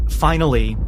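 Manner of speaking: usual speaking voice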